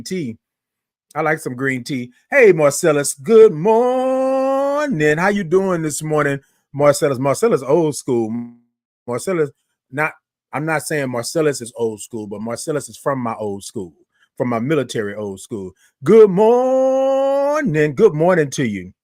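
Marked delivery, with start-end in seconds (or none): 3.1-5.2 long sung-out "good morning" drawn high and slow; drawn-out morning show greeting; stretched good morning. Shown as a demonstration. 16.0-17.9 long sung-out "good morning" drawn high and slow; drawn-out morning show greeting; stretched good morning. Shown as a demonstration.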